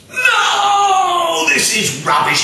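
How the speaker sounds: sudden high furious shout; furious cry. A narrator's reading. King rejecting the hundredth soup, roaring in fury. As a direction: blast it out as the enraged king. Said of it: "he cried".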